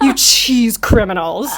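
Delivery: slow, playful accusing tone; a light playful accusation from the barman; mock scolding barman. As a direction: tease them like a pretend cop. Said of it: "And he goes".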